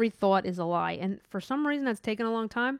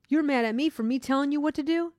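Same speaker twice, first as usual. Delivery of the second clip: higher-pitched mock-indignant question; mock indignation; 6 semitones higher than usual. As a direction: act mildly outraged at being blamed. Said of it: "gonna get mad at you".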